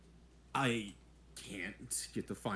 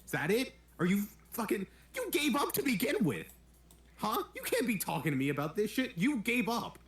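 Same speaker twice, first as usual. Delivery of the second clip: much higher, heated accusation; furious retort; 9 semitones higher than usual. Acted. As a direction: throw it back in his face. Heated argument with mentor.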